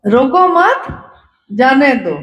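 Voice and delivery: रोको मत as one rising unit, pause, falling जाने दो; misplaced pause. Shown as a demonstration. Messenger repeating the garbled order, clear break after मत. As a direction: lift रोको मत, stop, then drop on जाने दो. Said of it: "लेकिन comma भूल गया वो".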